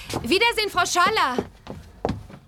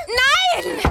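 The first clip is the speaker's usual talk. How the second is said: much higher, sudden cry of no; high sharp no; 11 semitones higher than usual. Acted. Bibi stopping Dicky crawling off, high sharp no. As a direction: stop the baby in its tracks.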